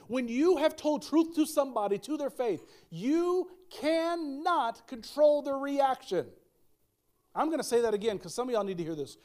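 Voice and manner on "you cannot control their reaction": "you cannot" stretched very slow and high; emphatic word by word. Real, not acted. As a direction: space out "you", "can", "not" for emphasis. Preacher drives home the key point.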